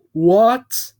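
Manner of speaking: loud, high what; surprised truck. Acted. Truck reacts to Bob's gift, loud and startled. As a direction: yelp it in surprise. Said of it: "the truck said".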